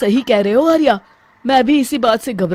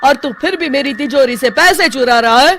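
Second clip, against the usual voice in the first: higher, louder scolding; indignant anger; 4 semitones higher, 5 dB louder than usual. Acted. Babban angrily confronting the thief at his safe.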